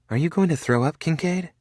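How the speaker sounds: high, playful teasing question. A narrator's reading. Felicity teasing nervous Cade, high and playful. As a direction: needle him lightly.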